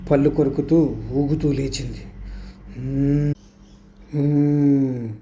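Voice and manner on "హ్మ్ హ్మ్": eerie hummed "hmm" twice, swaying; a haunted, closed-mouth hum; possessed humming. A narrator's reading. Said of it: "అని శబ్దం చేస్తూ ఊగుతున్న".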